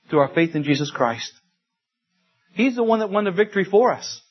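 normal talking voice

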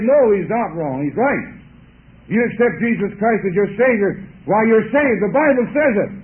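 the newly saved lady loudly defending the preacher to her brother-in-law; fervent insistence. Retold or imitated. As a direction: stand firm and build to a loud climax.

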